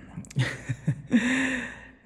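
shy high giggle; bashful giggle. Acted. Vampire pleased at captive's interest, bashful giggle. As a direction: laugh it away, flustered.

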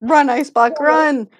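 high, loud excited yell; excited shouting. Real, not acted. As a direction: shout it like you can't believe it. Watching chaotic chase.

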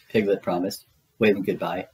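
ordinary delivery, nothing marked

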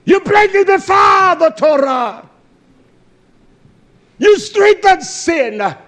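loud, high fiery preaching; preaching at full force. Acted. Preacher shouting down sinners at full force. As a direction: hammer it at the congregation.

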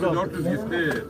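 ordinary talk

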